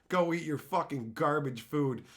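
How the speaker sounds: ordinary talk, nothing marked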